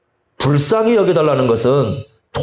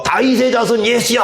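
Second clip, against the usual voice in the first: a much higher crying out to be heard; a blind beggar crying out to Jesus, higher and louder; 8 semitones higher than usual. Shown as a demonstration. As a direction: call out over a crowd. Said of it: "큰 소리로 막".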